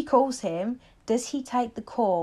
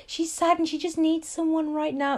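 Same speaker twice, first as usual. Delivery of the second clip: mocking guy excuse; 7 semitones higher than usual. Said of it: "They're always like".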